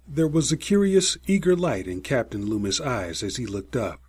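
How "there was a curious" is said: usual speaking voice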